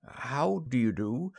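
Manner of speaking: doubtful. Acted. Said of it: "Nora, doubtfully".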